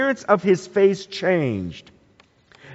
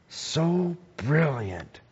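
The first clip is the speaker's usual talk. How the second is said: slow, much softer, awestruck; a soft, slow murmur of awe; hushed awe; 7 dB quieter, much slower than usual. Real, not acted. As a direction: let the wonder hush your voice. Awed pause about Jesus' glory.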